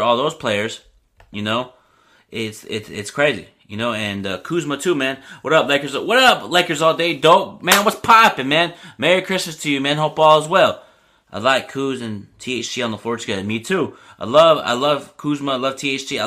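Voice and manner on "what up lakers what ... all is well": loud, high shout-out to a viewer; hyped greeting. Real, not acted. Greeting a chatter by name.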